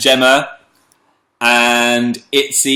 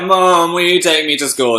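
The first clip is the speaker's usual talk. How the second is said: higher, imitated plea to mum; pleading child; 7 semitones higher than usual. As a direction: whine lightly for a lift.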